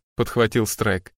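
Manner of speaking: plain reading voice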